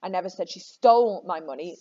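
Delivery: "stole" made the strongest word; stress on STOLE. Shown as a demonstration. Teacher shows students how meaning shifts, stressing "stole". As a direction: punch "stole" mid-sentence. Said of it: "She may have borrowed it".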